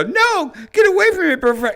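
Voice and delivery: very high-pitched mock protest; high, hammed-up impression of a man fending someone off; Andrew Santino. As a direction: play it as a squealed-up plea to back off. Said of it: "Andrew Santino go".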